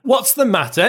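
high, indignant question; agitated exclamation. A narrator's reading. Prince Andrew so annoyed he switches language, high exclamation. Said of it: "exclaimed Prince Andrew, standing still in his excitement".

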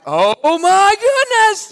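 put-on high, slow wailing child's cry; panicking child. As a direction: wail it like a hurt kid who just saw the cut. Child sees own wound, panics.

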